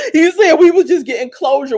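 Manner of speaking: high, loud, through laughter; laughing. Real, not acted. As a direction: crack up while retelling his excuse. Laughing at J K's excuse.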